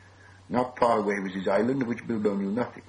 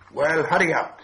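louder, higher, impatient call; raised, impatient voice; impatient urging; 7 semitones higher, 6 dB louder than usual. A narrator's reading. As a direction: hurry them along sharply. Bilbo impatient with Gollum.

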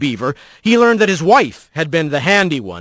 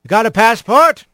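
raised voice, calling across; 5 semitones higher, 4 dB louder than usual. Acted. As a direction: call out to him from the gate. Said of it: "he called out".

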